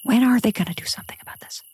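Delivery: fast, impatient whispered thought; frustrated whisper. Retold or imitated. Frustrated parent's private thought, fast whisper. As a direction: hiss the frustration under your breath.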